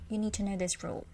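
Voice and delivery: ordinary talk, nothing marked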